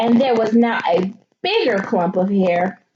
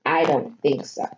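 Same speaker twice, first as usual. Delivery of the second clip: steely; 7 semitones lower, 3 dB quieter than usual. Acted. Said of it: "stared a steely stare".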